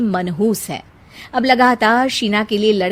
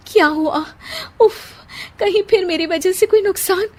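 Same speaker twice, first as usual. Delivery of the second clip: anxious worry; 9 semitones higher than usual. A narrator's reading. Sheena fears bad news.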